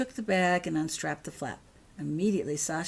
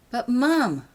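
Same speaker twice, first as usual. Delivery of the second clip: protesting; 7 semitones higher, 9 dB louder than usual. Acted. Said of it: "protested Holly".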